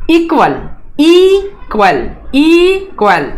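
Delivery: Equal with a high, stretched ई; teacher modelling equal stress; stress on first syllable. Shown as a demonstration. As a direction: lift the ई of equal. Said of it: "accent mark दिया हुआ है".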